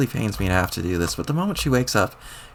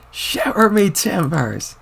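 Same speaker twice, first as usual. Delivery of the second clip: put-on high, very slow old-man cry; slow, high folksy exclamation; old-timer exclamation; 7 semitones higher, much slower than usual. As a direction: drag it out like an amazed old sailor grandpa. Old grandpa exclaims while powering up.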